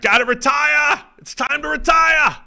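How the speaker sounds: slow, high, loud mock bellow; ranting fan impression. Mimicking calls for the other guy to retire, mock outraged shout. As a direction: holler it like a fed-up sports caller. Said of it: "the other guy is like".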